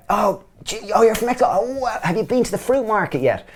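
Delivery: very high, gushing send-up of a chat-up; mock flirting. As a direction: overdo the wide-eyed interest, pitched right up. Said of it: "we used to hit on them and go".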